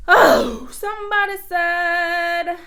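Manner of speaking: loud, slow, held-out words; loud drawn-out announcement. Real, not acted. Streamer spotting the next comment, loud drawn-out call. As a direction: call it out, stretching every word.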